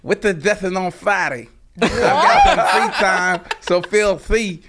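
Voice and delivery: high, slow lisping Sharpe voice; exaggerated Shannon Sharpe drawl; Shannon Sharpe impression. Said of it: "let me take a shot at it".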